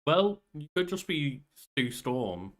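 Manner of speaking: ordinary talk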